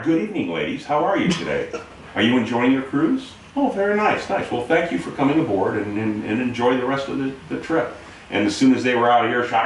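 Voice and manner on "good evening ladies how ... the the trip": 1 polite put-on. Chief suddenly polite to families.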